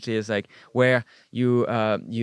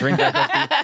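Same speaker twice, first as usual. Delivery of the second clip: high mock voice; 5 dB louder than usual. Mimicking French café habits.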